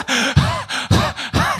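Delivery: high, winded gasps; exaggerated panting. Old person climbs ten steps, acted breathless panting. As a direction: puff as if worn out by stairs.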